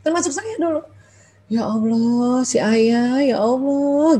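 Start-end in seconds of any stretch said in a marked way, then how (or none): 1.5-4.2 inward grumbling. Acted. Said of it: "terus kita mulai ngebatin".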